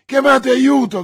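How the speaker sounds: high, very loud urgent orders; panicked cry, building in force; frantic shout. A narrator's reading. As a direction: shout desperately for help. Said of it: "gridò D'Artagnan".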